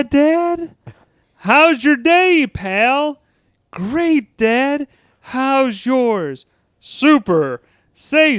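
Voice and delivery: high, sugary sitcom voices, slow and sweet; mock a too-perfect TV family; mock wholesome family. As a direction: Bender mocking Brian's home life with sweet sitcom voices. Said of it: "Here's my impression of life at Big Bry's house".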